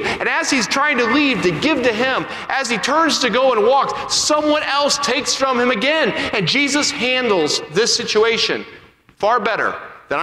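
high, driving sermon delivery; impassioned preaching. Real, not acted. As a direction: preach it with mounting urgency, stretching key phrases. Preaches at rising intensity.

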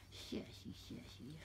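whispered sassy to the cat; soft unvoiced cat whisper. Real, not acted. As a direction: breathe it out to the kitty.

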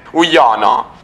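normal talking voice

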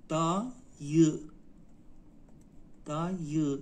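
دایی split slowly into دا, pause, یی; slow teaching demo of دایی, in two parts; syllable by syllable. Shown as a demonstration. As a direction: sound out دایی in two long beats. Teacher breaks word into syllables.

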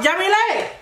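calling out. Real, not acted. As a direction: call the name across the house. Calling again, no answer.

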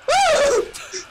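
high-pitched startled squeal; high scream. Real, not acted. Player yelps in a zombie game, high scream. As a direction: let out a very high yelp. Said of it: "That was such a manly scream".

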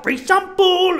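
very high, loud, stretched hustler yell; shouting hustler. Retold or imitated. Hustler stuffing coke in sock, high mock shout. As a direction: shout it as the imitated tout. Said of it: "He's just open up my sock".

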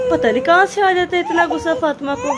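high, laughing through the words; amused laugh at her little tantrum. Real, not acted. Laughing about daughter's temper. (हँसते हुए बोलना)

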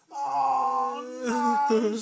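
high fed-up wail; exasperated dad. Acted. Sleepless dad at his wits' end, high wailing cry. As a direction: wail it like a worn-out father. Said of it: "said Dad".

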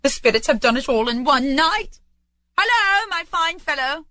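put-on high, elated old Scrooge; merry Scrooge. Scrooge hails a boy in the street, gleeful. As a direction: call out gleefully, pitched high.

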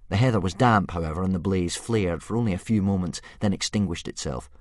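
usual speaking voice

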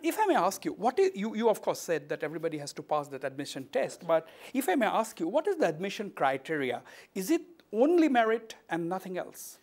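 ordinary talk, nothing marked

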